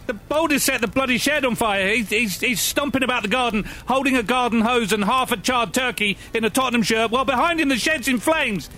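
high, frantic panic; panicked. Acted. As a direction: yell in rising alarm. Panicking as shed burns.

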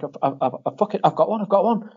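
jumps up high, hurried and breathless; a retold burst of excitement; excited. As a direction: blurt it out like you just won. Mate excitedly grabbing a console.

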